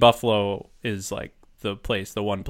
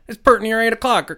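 much higher, louder twangy farmer voice; imitating a high-voiced farmer; farmer impression; 12 semitones higher, 8 dB louder than usual. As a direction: quote the farmer's folksy time call. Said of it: "So he would say".

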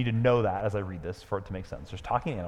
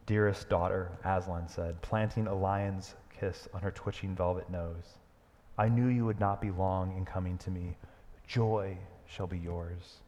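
tender lion; 4 semitones lower, 5 dB quieter than usual. Acted. Aslan comforting the trembling mare, tender and low. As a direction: speak like a loving father.

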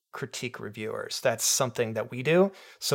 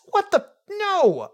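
high, loud squeak of disbelief; shocked outburst; 6 dB louder, much slower than usual. Real, not acted. Reacting to the review's insult with a shocked, high yelp.

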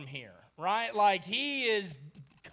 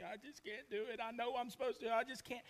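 mock sobbing; 10 dB quieter, faster than usual. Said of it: "sobbing in his hands".

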